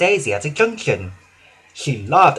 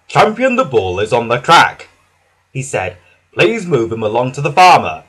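policeman's voice; 2 semitones lower, 6 dB louder than usual. Acted. Policeman speaking.

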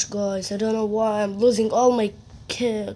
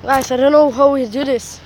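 excited cheer; 3 semitones higher than usual. Real, not acted. Gamer cheering a teammate's play to viewers, excited. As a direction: hype up the legend play.

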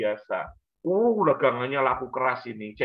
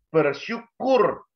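bersyukur drawn out slowly and pitched higher; stressed key word; 6 semitones higher, much slower than usual. Acted. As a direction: stretch out bersyukur high and slow. (menekankan kata bersyukur dengan lambat)

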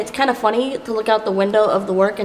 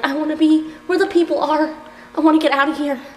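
higher-pitched mock longing; wistful play-acting; 6 semitones higher than usual. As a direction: whine it lightly as a playful wish. Quotes a musical line as a wish.

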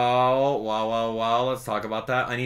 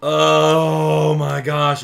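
slow, loud, high groan of dismay; drawn-out dismay; 5 semitones higher, 10 dB louder, much slower than usual. Real, not acted. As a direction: stretch it out in disbelief. Stuck in glitch.

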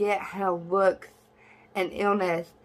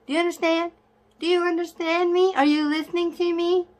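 higher, louder insistent demand; heated scolding; 10 semitones higher, 4 dB louder than usual. Real, not acted. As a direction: hammer the question at them, exasperated. Passionate rant scolding viewers.

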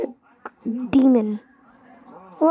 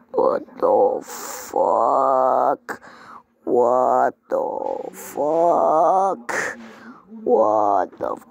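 slowed-down voice; 12 semitones lower, much slower than usual. Pretend. Gamer in mock slow-motion voice.

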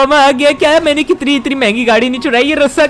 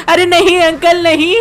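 high, stretched-out mock wail; exaggerated cry of protest, held long; hammed-up plea; 5 semitones higher than usual. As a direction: plead with the cop in playful panic. Pleading with police during chase.

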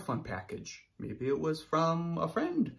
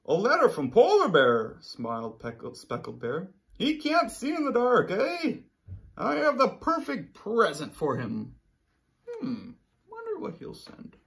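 higher, louder friendly bear; Speckled Bear voice; 7 semitones higher, 6 dB louder than usual. Acted. Speckled Bear reading the letter, higher and louder. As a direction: play the cheerful speckled bear.

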